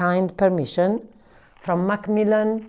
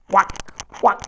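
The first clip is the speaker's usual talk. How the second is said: voiced quack. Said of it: "I quack like a yak".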